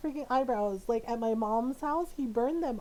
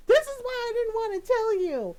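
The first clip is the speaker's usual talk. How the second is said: whiny protest; 8 semitones higher, 6 dB louder than usual. Retold or imitated. Brother protesting to mom.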